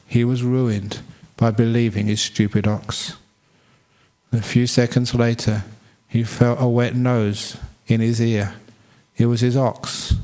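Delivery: usual speaking voice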